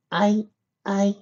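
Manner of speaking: high, halting filly voice; stuttering filly. A narrator's reading. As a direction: stammer as a small filly hunting for words. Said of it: "the filly stuttered".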